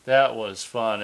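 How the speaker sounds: normal talking voice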